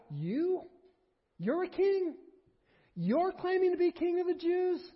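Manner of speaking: high, incredulous scoff; mocking Pilate. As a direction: sneer at this beaten man's claim. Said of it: "has this guy".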